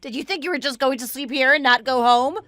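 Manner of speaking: normal talking voice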